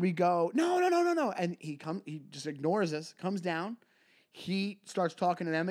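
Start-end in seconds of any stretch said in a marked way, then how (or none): 0.6-1.3 mock panicked refusal. Refusing the host.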